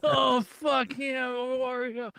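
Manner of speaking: comedian's hammed-up moaning voice; mock moaning. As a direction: stretch it out like an over-the-top moan. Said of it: "this guy just being like".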